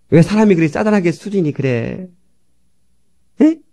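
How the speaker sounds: ordinary delivery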